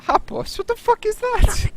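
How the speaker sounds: very high, loud squeak of disbelief; shocked disbelief. Real, not acted. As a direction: shriek at the nonsense word. Baffled by mangled Welsh.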